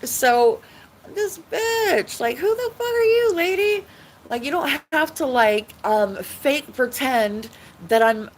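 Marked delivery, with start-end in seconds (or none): 0.0-3.8 high, indignant who the fuck are you; outraged retort. Retold or imitated. Confronting the neighbor who gave the pill, high outraged. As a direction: snap back, pitched way up in outrage. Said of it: "And I'm like".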